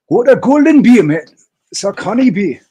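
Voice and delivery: high, loud Geordie accent, a big put-on Newcastle voice; hammed-up Newcastle accent, belting it out high. Streamer shows off his Newcastle accent, loud, high Geordie voice. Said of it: "That's my Newcastle accent".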